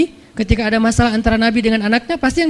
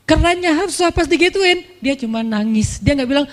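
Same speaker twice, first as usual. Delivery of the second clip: ordinary delivery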